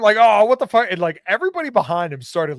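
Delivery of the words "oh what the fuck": surprised outburst. Retold or imitated. Retelling Portnoy's reaction.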